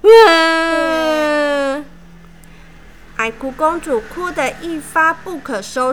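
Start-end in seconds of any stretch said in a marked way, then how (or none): 0.0-1.8 loud, held crying wail. A narrator's reading. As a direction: let the wail swell. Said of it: "越哭越大声".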